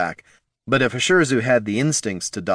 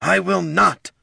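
high defiant snarl; 10 semitones higher, slower than usual. A narrator's reading. Wounded sister snarling her refusal. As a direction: refuse through the pain. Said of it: "Ushirzu snarled".

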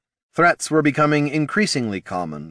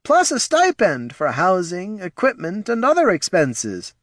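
nervous advisor voice; 13 semitones higher than usual. Acted. Reads Ortson's dialogue.

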